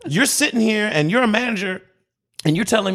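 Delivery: high-pitched, exasperated rant; fed-up customer telling off a manager; irate yelling. Retold or imitated. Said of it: "I'm yelling because this is a messed up situation".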